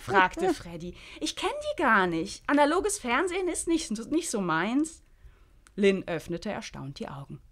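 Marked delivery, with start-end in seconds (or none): 1.2-5.0 put-on high, lively young woman; Freddy's voice. Freddy brushing off old TV hosts, high and breezy. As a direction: pitch it up, breezy and carefree.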